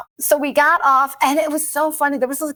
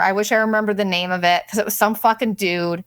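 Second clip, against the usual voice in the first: usual speaking voice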